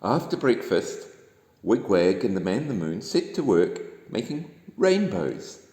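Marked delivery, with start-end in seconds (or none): none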